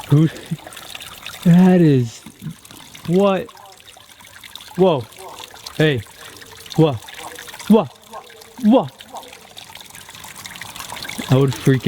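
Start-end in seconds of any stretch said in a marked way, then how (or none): 0.1-3.5 surprised. Real, not acted. Startled by odd trail. 4.8-8.9 calling out. Real, not acted. Calling out to test echo.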